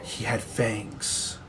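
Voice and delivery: slow, soft and heavy; grim hushed admission. Acted. Priest describing his son's fangs, slow and hushed. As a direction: drag it out quietly.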